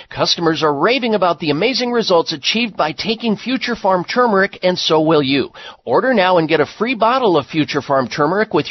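upbeat ad read; infomercial. Acted. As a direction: read it like a radio spot. Reads a turmeric ad.

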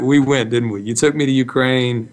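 normal talking voice

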